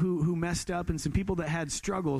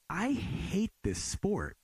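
slow disgusted; 3 semitones higher, much slower than usual. Retold or imitated. Frustrated on course.

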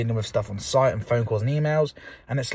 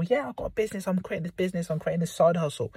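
higher, upbeat bragging; proud boasting; 5 semitones higher, 3 dB quieter than usual. Retold or imitated. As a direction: boast about the business. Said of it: "when you go out football".

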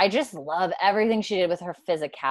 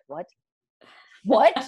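imitating a double take, second one louder; double what; 3 semitones higher, 5 dB louder than usual. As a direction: repeat it after a stunned pause, higher. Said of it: "his, like, his double what".

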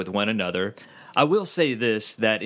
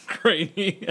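laughing echo of "Grainy"; 8 semitones higher than usual. Real, not acted. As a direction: repeat "Grainy" while laughing. Laughing repeat of his word.

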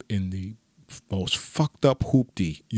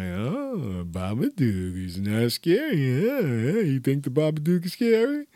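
mocking taunt; 5 semitones higher than usual. Date mocking him for being scared of the Babadook, teasing. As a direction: rub it in that he got scared. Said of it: "She was like".

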